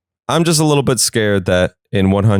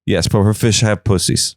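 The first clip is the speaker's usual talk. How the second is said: ordinary talk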